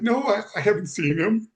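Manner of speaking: high voice, choking up for real; fighting back tears while telling the story; tearful. Real, not acted. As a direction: voice lifted by real emotion. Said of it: "I started crying like I am now".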